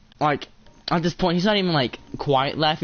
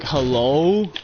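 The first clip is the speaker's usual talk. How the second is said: deep bass voice; 4 dB louder than usual. Retold or imitated. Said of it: "in the deepest voice ever".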